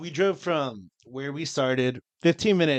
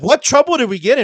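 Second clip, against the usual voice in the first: indignant retort; 12 semitones higher, 10 dB louder than usual. Retold or imitated. Defiant reply quoted to cops.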